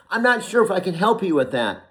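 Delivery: a hint of a stiff assistant voice; corporate Alexa voice. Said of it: "It was the old voice that came into our conversation".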